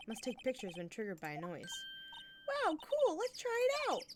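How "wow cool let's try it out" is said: high cartoon voice. Squeaky voiced character.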